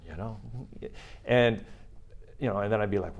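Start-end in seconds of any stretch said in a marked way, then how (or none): none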